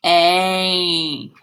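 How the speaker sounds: a held long A vowel; long A sound. Shown as a demonstration. Teacher models the long A sound for children. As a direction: hold the A for the class to repeat. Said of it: "Say it with me".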